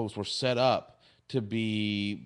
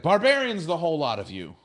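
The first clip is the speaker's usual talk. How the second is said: mock denunciation; 5 semitones higher, 5 dB louder than usual. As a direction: thunder it like an outraged guardian. Retold guardian denounces the crew.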